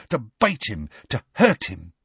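plain reading voice

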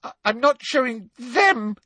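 high, stammered refusal; shrill. A narrator's reading. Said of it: "said Hermione shrilly".

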